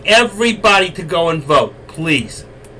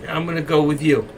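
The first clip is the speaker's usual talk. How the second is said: usual speaking voice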